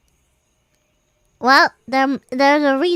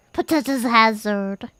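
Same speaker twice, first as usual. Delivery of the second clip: ordinary talk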